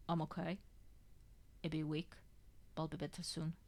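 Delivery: soft, weak mumble; mumbling. A narrator's reading. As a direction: mumble it back weakly. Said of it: "he mumbles back".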